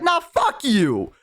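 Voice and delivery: high, drawn-out mock defiance; retold defiance. Bullied patron talking back to the orcs, defiant. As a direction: stretch it out as a defiant refusal.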